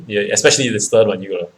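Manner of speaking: ordinary delivery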